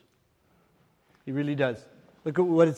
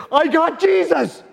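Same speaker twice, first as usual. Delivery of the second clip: high, loud, slow joyful cry; excited quote; 11 semitones higher, 8 dB louder, slower than usual. Retold or imitated. Friend announcing his conversion on the phone, joyful high cry. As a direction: proclaim it with pure joy. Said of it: "And he goes".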